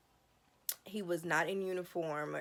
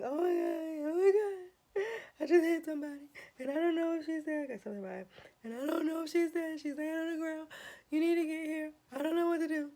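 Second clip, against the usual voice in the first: much higher, near-sobbing phone call; crying panic; 11 semitones higher, 3 dB louder, faster than usual. Retold or imitated. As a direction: wail it out like you just hit someone. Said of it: "I'm like".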